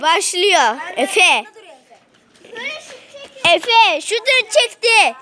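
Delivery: quick live sports call; play-by-play commentary. Acted. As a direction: call the match as it happens. Live commentary of kids' match.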